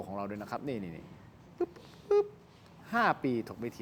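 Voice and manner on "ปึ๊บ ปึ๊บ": two short high-pitched pops; imitated popping noise. Shown as a demonstration. Host showing off the case, imitated pops. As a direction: make two tiny, high popping sounds.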